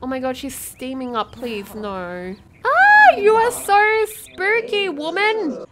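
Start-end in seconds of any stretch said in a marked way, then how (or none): 2.6-5.6 high startled scream easing into loud complaint; shriek, then blurt it out; loud, high-pitched jump-scare reaction; spooked. Real, not acted. Startled by steaming ghost woman.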